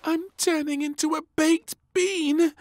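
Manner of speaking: put-on a worried little girl alarmed; gasping little princess. Princess fearing she turns into a bean, gasping. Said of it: "she said with a gasp".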